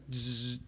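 soft buzzing zap; a low-key electric buzz; imitated zap. Preacher showing what he just did, buzzing softly.

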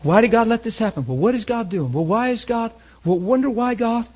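high-pitched imitation of puzzled complainers; acted bewildered questioning, pitch lifted high; mock questioning of God. Voicing people who question God.

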